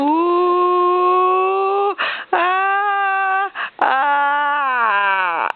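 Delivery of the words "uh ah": high playful "uh, ah"; playful airplane cry. Real, not acted. Airplane spoon noise.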